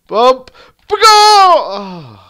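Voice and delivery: high, loud cry; anguished yell. Real, not acted. Gamer crying out at a miss. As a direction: scream it as it misses.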